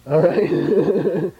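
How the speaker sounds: breaking into a laugh. Real, not acted. Teacher laughing over confusing identical words, amused check-in. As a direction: check in, then dissolve into laughter.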